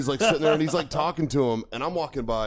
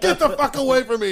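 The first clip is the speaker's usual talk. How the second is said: loud, high retold shout; angry dismissal; 12 semitones higher, 6 dB louder than usual. Veteran chasing off the apologetic instructor, mock furious shout. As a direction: yell to send him running. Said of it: "I'm fucking going off on him".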